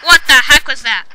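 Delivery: loud, startled demand; startled exclamation. Real, not acted. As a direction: burst out at a sudden noise. Startled by an unexpected noise.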